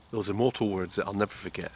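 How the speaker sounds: usual speaking voice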